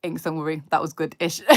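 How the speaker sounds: ordinary talk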